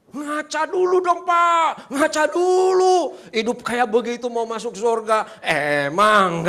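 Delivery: high, very loud scolding; scornful shout. Retold or imitated. Driver mocks B's claim to heaven, scornful shout. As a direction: shout it in mock outrage, stretching words.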